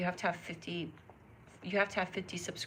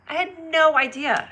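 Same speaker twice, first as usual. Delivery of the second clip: louder and higher, stress on no; stunned; 7 semitones higher, 8 dB louder than usual. Real, not acted. Streamer amazed she missed the news, higher and louder. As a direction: burst out with amazement on no. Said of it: "repeated louder".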